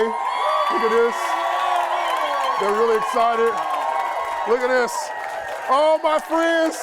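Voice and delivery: loud, high hype-man video greeting; hyped video shout-out. Real, not acted. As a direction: pump the whole room up for the camera. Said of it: "I want y'all to stand and yell".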